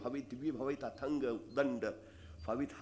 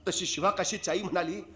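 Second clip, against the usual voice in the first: ordinary talk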